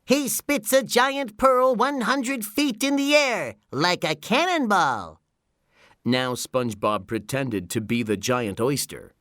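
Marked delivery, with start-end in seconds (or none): 0.0-5.2 very high cartoon showman's announcement; high-pitched, booming cartoon announcer; loud announcer's voice. A narrator's reading. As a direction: proclaim it like an excited ringside announcer, pitched high. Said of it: "in a loud announcer's voice".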